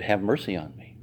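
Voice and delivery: a soft, low, muted begging; quiet plea. Retold or imitated. The mother asks Jesus for mercy, a soft plea. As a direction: hold it back, low and soft. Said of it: "She cried out to him".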